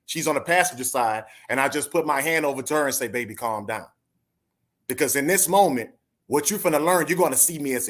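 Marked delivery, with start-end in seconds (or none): 3.1-3.9 softer, gentle reassurance; calm her down softly; soothing. Retold or imitated. Man softly reassuring his frightened wife in the car.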